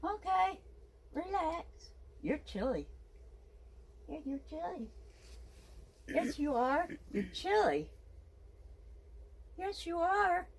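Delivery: high cooing pet-talk. Real, not acted. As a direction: coo at the puppy in a high lilting voice. Cooing to her dog Jasmine.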